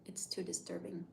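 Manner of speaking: whispered unease; hushed. Real, not acted. Streamer admitting horror disturbs her, whispering. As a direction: half-whisper it with a shudder.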